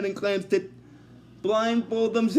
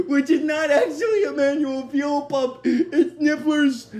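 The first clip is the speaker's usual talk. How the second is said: higher, amused, through laughter; laughing while talking; 6 semitones higher, 3 dB louder than usual. Real, not acted. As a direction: explain it while giggling. Amused by his own retelling.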